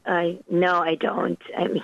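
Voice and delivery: ordinary talk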